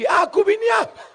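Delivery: high indignant shout; an angry wife shouting at her husband; shouting back. Retold or imitated. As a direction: yell it high and loud, offended.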